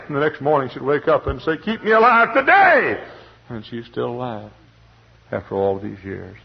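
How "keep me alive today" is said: high, loud, slow pleading cry; crying prayer. Retold or imitated. Widow crying out to God, high and loud. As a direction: cry out to God for one more day.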